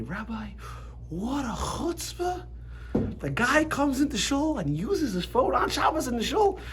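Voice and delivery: high, drawn-out imitation of an outraged complainer; mock indignation. Congregant complaining to the rabbi about a phone, mock outrage.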